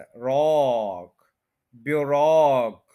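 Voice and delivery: रॉक drawn out, stressed; ब्यूरोक with the stress on ROCK; slow stress demo. Shown as a demonstration. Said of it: "रॉक पे stress है".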